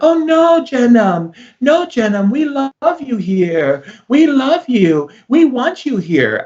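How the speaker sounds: high squeaky falsetto, gushing and overly sweet; mock sweet denial. People in Turkey insisting they love him, in a mock falsetto. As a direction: lay on the fake sweetness in a tiny high voice.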